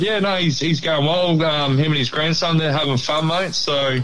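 ordinary talk

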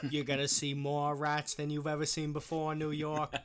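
put-on JFK-style Boston accent. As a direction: talk like a Boston politician. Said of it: "You're doing that with a Boston accent".

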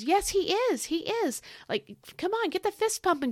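high, excited cheering of praise; getting excited. Real, not acted. Preacher cheering listeners to pump their fists, excited. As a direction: lift it up like a fist pump. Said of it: "I'm getting excited".

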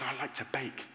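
quiet confession. Retold or imitated. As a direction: say it quietly, almost hiding it. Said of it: "he says it really quietly".

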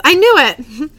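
high, loud fangirl outburst; triumphant excitement. Acted. Fan told it is all real, triumphant excitement. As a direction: burst out in vindicated glee.

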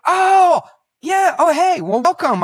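loud, high drawn-up exclamation; mock sudden recognition. Host finally greets the guest, mock recognition. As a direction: feign a big dawning realisation, shouted high.

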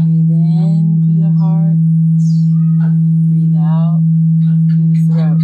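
slow meditation voice over bowl; guided meditation. Acted. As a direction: guide each breath, unhurried.

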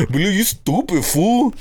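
a hint of the brother insulting; imitating brother. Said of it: "talking to my brother".

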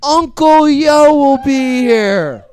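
names stretched out slowly; announcer billing. Real, not acted. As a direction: draw out each name like a ring announcer. Host bills the performers.

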